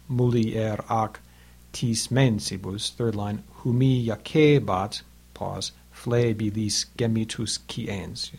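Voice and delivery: longs and shorts weighed slowly through "mulier ac tis mensibus"; slow scansion. Shown as a demonstration. Teacher scans the Latin lines aloud for students. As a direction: let each long syllable sit. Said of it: "Hopefully you can hear that".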